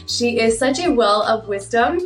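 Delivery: ordinary delivery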